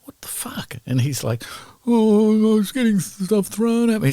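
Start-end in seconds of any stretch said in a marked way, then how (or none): 0.0-0.8 hissed, pitched-up disbelief; furious hushed squeal; high angry whisper. Retold or imitated. Chrissy angry in dressing room. 1.9-4.1 high whiny defensive voice; whiny excuse. Retold or imitated. Guitarist whining excuse.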